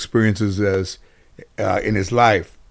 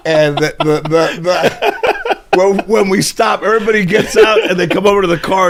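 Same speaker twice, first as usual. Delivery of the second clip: telling it through laughter, pitched up; high, laugh-filled storytelling; 9 semitones higher than usual. Real, not acted. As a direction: keep talking while cracking up. Cracks up at own story.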